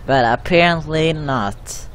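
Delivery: usual speaking voice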